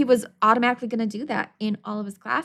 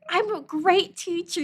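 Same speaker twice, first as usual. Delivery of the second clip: mock proud; 10 semitones higher than usual.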